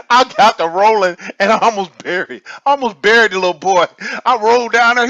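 laughing. Real, not acted. Laughing retelling of rolling downhill.

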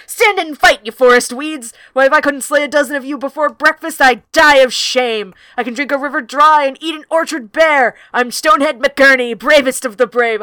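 loud, booming proud owl proclaiming himself; boastful owl roar. Acted. Reading out the barn owl's boastful entrance, booming loud. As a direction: announce yourself like a swaggering warrior. Owl shouts at fleeing weasels.

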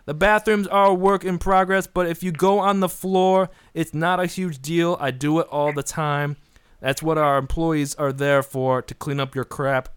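lightly voiced New York pitchman; brash New York ad read, winding down; New York accent. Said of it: "do it with, like, a New York accent".

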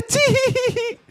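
very high forced giggle "Tee"; dainty fake giggle; fake laugh. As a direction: squeak out a phony tittering laugh. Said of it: "fake laugh".

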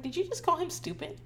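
high, startled disbelief; surprised question. Retold or imitated. Mom catching her son's insult on video, high surprised. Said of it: "I was like".

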